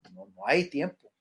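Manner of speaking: ordinary talk, nothing marked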